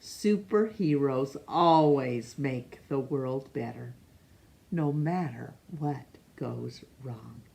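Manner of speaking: ordinary delivery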